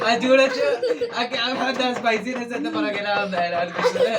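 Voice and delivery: high, laughing commentary. Real, not acted. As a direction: laugh out the words. Laughing at girl's spicy face. (నవ్వుతూ మాటలు)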